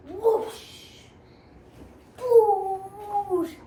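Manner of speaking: loud high whoosh sound; whooshing noise. Acted. Reader voicing the spaceship flying past, loud whoosh. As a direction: make the rocket swoosh.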